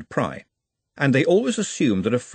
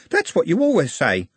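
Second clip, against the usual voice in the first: a higher, knowing lift on the line; teasing, pointed repeat; pointed inflection; 7 semitones higher than usual. Acted. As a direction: let the words carry a hidden barb. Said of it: "An inflection caught Glenda's ear".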